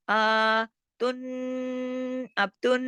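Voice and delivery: तुन with a long nasal hum on n; big ghunna. Shown as a demonstration. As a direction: hold the n of तुन in the nose. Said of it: "इसी तरह से बड़ा गुन्ना होगा". (तुन पर लंबा गुन्ना)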